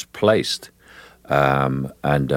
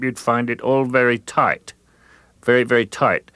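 higher, tight throat-placed voice; 6 semitones higher than usual. Shown as a demonstration. Describing the tight windpipe feel, higher throat voice. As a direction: keep it squeezed high in the throat. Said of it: "if I talk up here".

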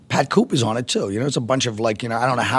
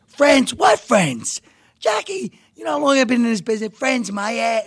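mock screaming; 12 semitones higher than usual. Colin imitates Pat Cooper screaming at Jackie, mock furious yelling. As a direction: blow up like a furious old comic. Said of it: "he starts screaming at me".

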